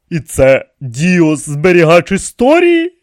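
high, loud indignant question. A narrator's reading. King questions the keeper of history, indignant. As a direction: protest it in outraged disbelief. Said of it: "обурився Тепікімон".

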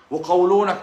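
ordinary talk, nothing marked

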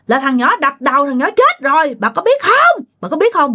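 high-pitched ghost reproaching her mother-in-law; high, accusing questions; reproachful daughter-in-law. A narrator's reading. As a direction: confront her in a high, insistent voice. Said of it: "bà Hảo mới nói rằng".

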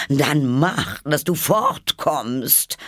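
high, slow grumpy pigeon; high bird voice, peevish dismissal; sullen. A narrator's reading. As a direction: shoo her off in a sulk. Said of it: "sagte die Taube verdrießlich".

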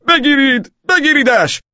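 very high, loud chasing cry; high-pitched police shout; shouting in pursuit. A narrator's reading. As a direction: yell to catch the runaway.

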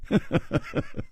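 soft, high, voiceless chuckle; leisurely chuckle. Acted. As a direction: chuckle lightly to yourself, unhurried. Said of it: "楚留香悠悠地笑着说". (悠悠地轻笑)

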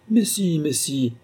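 insistent, first word jumping high; lightly pushy; insisting. A narrator's reading. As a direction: press the point, brushing off the protest. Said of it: "il insista".

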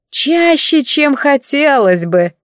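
high, slow and snide; snide remark. A narrator's reading. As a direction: drawl it out mockingly. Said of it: "с ехидным комментарием".

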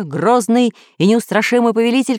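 ordinary delivery

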